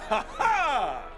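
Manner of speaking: booming heroic ha ha; hearty laugh. Acted.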